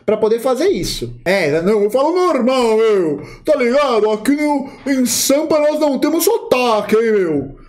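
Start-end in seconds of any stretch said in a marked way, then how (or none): 1.3-7.6 exaggerated São Paulo accent, higher; playing the proud paulista who claims no accent; mock paulistano accent. Mocking a paulista insisting he speaks normal, paulistano accent. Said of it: "Ensinado que paulista não tem sotaque".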